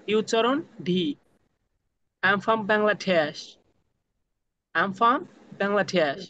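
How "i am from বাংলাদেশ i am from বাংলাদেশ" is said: slow drill. Shown as a demonstration. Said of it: "self-introduction drill, slow".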